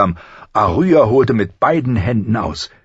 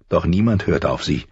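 plain reading voice

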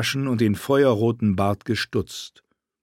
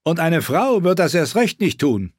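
boastful knight; 8 semitones higher, 4 dB louder than usual. Acted. Knight voiced boldly.

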